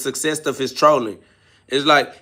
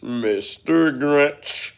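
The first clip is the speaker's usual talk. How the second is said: very slow, drawn-out Grinch name in a put-on voice; much slower than usual. Streamer mocks the troll's Christmas-hating persona, slow drawl. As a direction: stretch the name out with a sneer.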